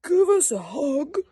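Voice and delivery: loud eager plea; crying out. Acted. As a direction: call out eagerly for a hug. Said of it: "cried Douglas".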